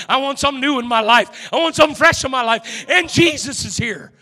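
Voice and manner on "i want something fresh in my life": ordinary delivery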